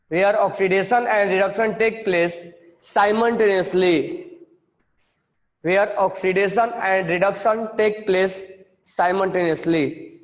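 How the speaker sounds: slow, repeated phrase-by-phrase dictation. Shown as a demonstration. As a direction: repeat each phrase slowly for writing. Dictating definition, repeating each phrase.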